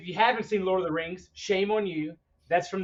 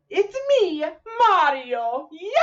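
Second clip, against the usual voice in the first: high, exuberant video game hero voice; Mario; 11 semitones higher, 4 dB louder, slower than usual. Acted. Podcaster imitating the classic game catchphrase, Mario voice. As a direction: shout it like a cartoon game mascot. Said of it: "From the classic Mario games".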